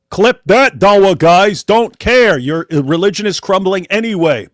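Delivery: loud, high, fired-up taunt; defiant loud. Real, not acted. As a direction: throw it at the rivals, full volume. Challenging opponents, fired up.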